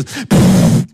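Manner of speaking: unvoiced puff of a knockdown; mock blow sound. Preacher showing Jesus' blood striking down evil, puff. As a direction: blow it away in one breath.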